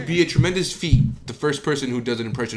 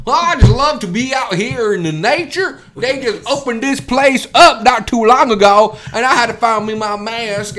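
higher, louder put-on Karen; 7 semitones higher, 7 dB louder than usual. Karen at a comedy show talking to the host, higher loud put-on voice. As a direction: play a ditzy complaining Karen. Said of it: "A lot of those Karens".

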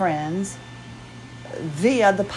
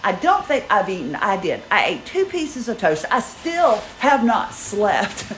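ordinary delivery

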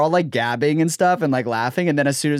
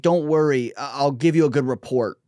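ordinary talk

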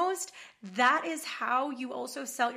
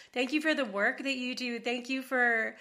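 usual speaking voice

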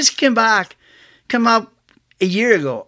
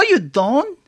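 ordinary talk, nothing marked

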